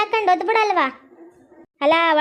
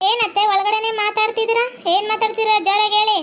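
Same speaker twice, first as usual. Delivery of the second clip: ordinary talk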